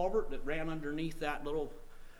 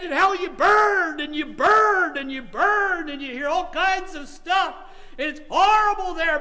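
imitated hellfire shout; 12 semitones higher, 10 dB louder than usual. Preacher retelling a man's terror of hell, shouted. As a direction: hammer each phrase loud and stretched.

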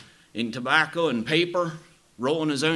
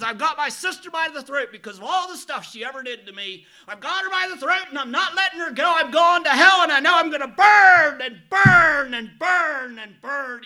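high, loud, building fury; retold bitter rage; 10 dB louder than usual. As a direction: let the rage grow with every burn. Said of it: "I am angry".

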